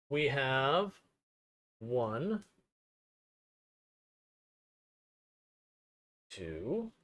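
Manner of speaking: muffled, off-mic and distant; talking away from the mic. Real, not acted. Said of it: "I do apologize for it being a little bit muffled".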